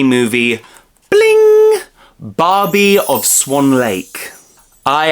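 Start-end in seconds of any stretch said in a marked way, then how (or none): 1.1-1.8 sparkly bling sound. Pretend. Sparkle sound before movie title. 2.2-4.3 dramatic title announcement. Pretend. Host announces the movie title.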